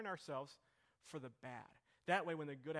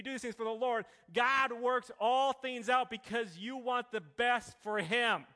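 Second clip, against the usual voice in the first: preaching at full force; 6 semitones higher, 10 dB louder, slower than usual. Acted. Preaching climax.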